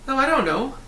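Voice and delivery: put-on high airy young voice; a hint of a cheerful boy; light boy voice. A narrator's reading. Floating boy answers Milo, high and light.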